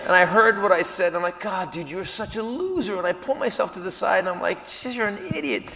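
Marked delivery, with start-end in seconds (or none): 1.4-3.0 quiet, disgusted aside to yourself; hushed self-reproach; self-scolding mutter. Retold or imitated. Berating himself aside. 4.8-5.6 quiet, disgusted aside to yourself; hushed self-reproach; self-scolding mutter. Retold or imitated. Berating himself aside.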